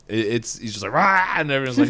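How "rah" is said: short, loud, high cartoon roar; mock angry roar. Frozen wood creature roars at everyone, mock angry roar. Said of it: "angry, like".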